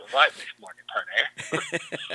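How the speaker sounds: lightly voiced Scottish film-star impression; a hint of a lisping Scottish brogue; Sean Connery. Shown as a demonstration. Playing along as the film star on cue, in a light Scottish brogue. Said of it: "you're Sean Connery. Go ahead, say it".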